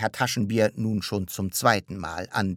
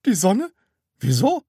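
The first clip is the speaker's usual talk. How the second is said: high, loud, astonished questions; baffled; 12 semitones higher, 9 dB louder than usual. A narrator's reading. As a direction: ask in sheer puzzlement, rising at the end. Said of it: "Frau Rotkohl war verblüfft". (verblüfft)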